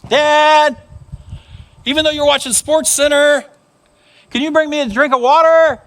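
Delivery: put-on high, loud child pleading from bed; whiny kid calling out to dad; little boy calling Dad. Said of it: "the boy said".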